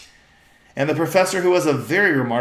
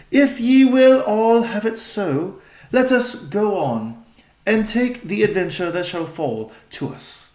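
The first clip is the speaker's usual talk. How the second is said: higher, slow queenly consent; regal old-fashioned speech; 7 semitones higher, 5 dB louder, slower than usual. Acted. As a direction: yield gravely in Aslan's name. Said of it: "their voice has changed".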